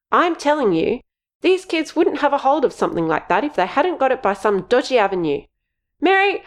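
high, insistent scolding; angry. Acted. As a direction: cut him off and push on. Missus J arguing.

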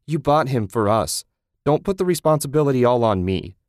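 loud argument. Acted. Susan arguing, louder.

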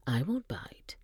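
breathy teasing whisper. Acted. Janet teasing Dom in a hushed whisper.

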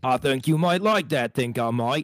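high, hammed-up cartoon Brit; Popeye-like woman. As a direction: play the ridiculous-voiced lover. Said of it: "she talked like that, like fucking Popeye".

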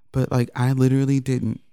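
normal talking voice

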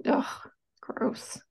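disgusted whispered ugh; breathy, disgusted groan; annoyed ugh. Real, not acted. Disgust at wasted decade.

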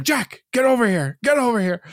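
high shouty summons; calling out. Retold or imitated. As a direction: yell for Jack across the room. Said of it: "it's like".

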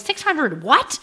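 high, loud incredulous outburst; exaggerated disbelief with a sharp rising finish; mock sticker shock. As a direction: balk at the price, voice leaping up. Acts out shock at price.

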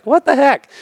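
mock astonishment. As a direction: squeak it out in disbelief. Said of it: "he's like".

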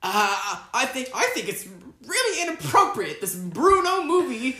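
high, put-on disapproving host; Barbara Walters. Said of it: "Barbara Walters".

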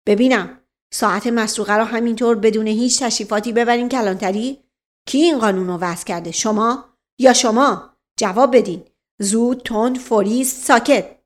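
bullying police reprimand ending in punched commands; scowling inspector. Acted. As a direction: take offence and demand an answer. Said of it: "اخم کرد و گفت".

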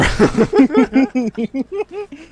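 squealing burst of laughter; loud high cackle; high-pitched laugh. Real, not acted.

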